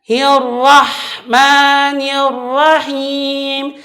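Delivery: slow hirrahmanirrahim; wrong recitation. Shown as a demonstration. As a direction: hirrahmanirrahim drawn out. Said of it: "kesalahan ketika dia membacakan". (hirrahmanirrahim salah)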